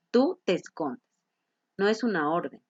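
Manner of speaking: ordinary talk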